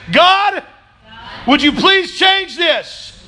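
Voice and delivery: high, slow, called-out plea; loud pleading prayer. Shown as a demonstration. Preacher leading the church in prayer, loud slow plea. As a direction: lead the room in a loud, slow cry to God. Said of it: "Just say".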